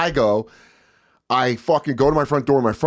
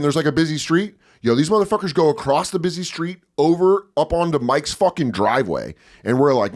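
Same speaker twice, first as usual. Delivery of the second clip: normal talking voice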